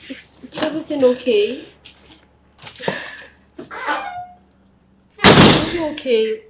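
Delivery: alarmed concern. Acted. Friend greets crying Linda at the door, alarmed. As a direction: rush to her, alarmed.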